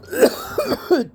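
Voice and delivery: hacking smoker's cough; ragged coughing fit; harsh cough. Real, not acted. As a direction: cough it out hard. Coughing after hit.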